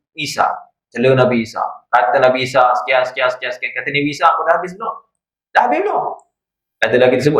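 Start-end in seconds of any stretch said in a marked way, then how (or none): 5.6-6.0 pitched much higher, rising at the end; the same question pushed up high; higher repeated question. Acted. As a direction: ask again, up high and pointed. Nabi Isa asks the mocker again.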